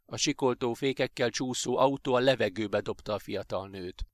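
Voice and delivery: plain reading voice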